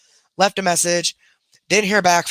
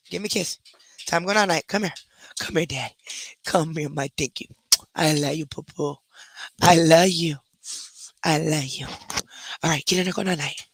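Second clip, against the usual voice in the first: tender goodnight; 4 dB quieter than usual. Real, not acted. Putting dog to bed.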